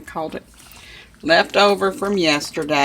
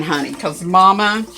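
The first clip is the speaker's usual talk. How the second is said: usual speaking voice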